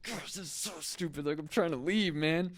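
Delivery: exasperated retold gripe; frustrated rant. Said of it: "I think we all relate to those feelings".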